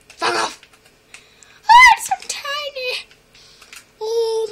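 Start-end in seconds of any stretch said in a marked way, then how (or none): 1.7-4.5 very high, loud squeal; squealing with delight. Real, not acted. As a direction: gush over how tiny it is. Squealing at tiny baby.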